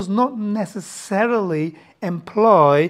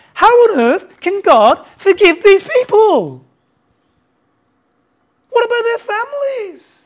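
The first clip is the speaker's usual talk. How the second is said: impassioned outcry; 11 semitones higher, 6 dB louder than usual. Real, not acted. Preacher crying out to the congregation in outrage. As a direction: cry out against the injustice.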